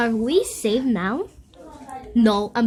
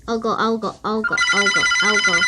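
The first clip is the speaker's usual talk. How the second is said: growing louder each I'll go; eager repeat; 6 dB louder, faster than usual. Real, not acted. Kid volunteering to go, building louder.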